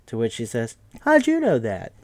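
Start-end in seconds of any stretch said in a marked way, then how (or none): 1.0-1.9 put-on high squeaky old woman, startled and a bit louder; lightly voiced old lady caught out; surprised old lady. Old Missus Paley startled that they guessed, high mock surprise. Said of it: "from the old lady side".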